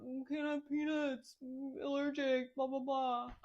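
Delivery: slow, high mocking whine; mocking imitation. Mother imitating freaked-out teachers, mocking. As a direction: drag it out to mock the fussers. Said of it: "they're all freaking out".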